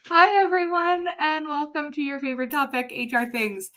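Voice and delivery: mock-cheery high singsong welcome; sarcastic. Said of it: "That is sarcasm".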